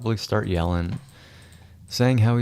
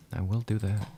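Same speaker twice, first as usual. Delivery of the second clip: ordinary delivery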